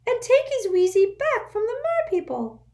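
urgent little servant squeaking; an anxious house elf waking his master; squeaky house elf. A narrator's reading. Dobby voiced.

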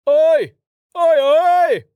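loud, high roar calling out monsters. Acted. Carten roars into the snow to draw out monsters. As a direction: bellow it out to draw the beasts. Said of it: "Carten roared".

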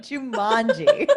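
performed slow Jumanji. Shown as a demonstration. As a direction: stretch Jumanji out with a laugh. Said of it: "You say it, [name]".

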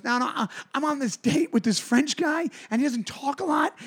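high-pitched rambling excuse; put-on high woman's voice; mimicked drunk woman. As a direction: imitate her explaining herself, up high. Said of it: "And she goes".

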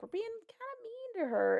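put-on high timid girl voice; quoting Abby. Abby voiced in retold story.

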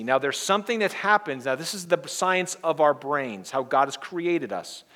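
ordinary delivery

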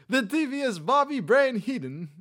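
high, loud wrestling announcer voice; hammed-up Heenan intro, not quite right; Bobby Heenan impression. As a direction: introduce him like a ring announcer. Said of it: "it's not that good".

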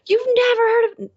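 highest, most shocked mock incredulity; a friend hamming up total shock at her; peak disbelief. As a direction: push the disbelief to its top. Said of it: "And they're like".

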